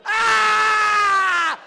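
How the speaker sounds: very high, very loud shriek of fright; child's terrified scream. Retold or imitated. Little kid seeing the giant, screaming in terror. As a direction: shriek like a scared four-year-old. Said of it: "the other one starts screaming".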